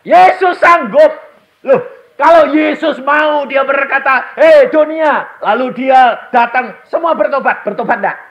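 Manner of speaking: high, loud, slow sermon shout; booming preacher voice; full-force preaching. Acted. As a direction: proclaim it to the whole hall.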